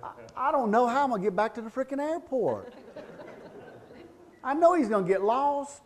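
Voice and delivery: high, loud comic complaint; mock exasperation. Passenger griping at Tim about the airport, high mock exasperation. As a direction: whine loudly about your driver, hammed-up.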